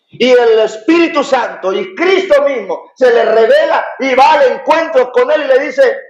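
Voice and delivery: high, impassioned pulpit delivery; fervent preaching. Acted. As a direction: proclaim it fervently to the congregation. Preaches at full force.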